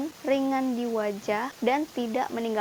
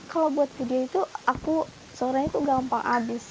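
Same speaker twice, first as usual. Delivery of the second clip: hoarse voice; 3 semitones higher than usual. Real, not acted. Vlogger explaining her voice runs out, hoarse and strained. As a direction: talk through a voice that is going. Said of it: "aku kayak serak gitu loh".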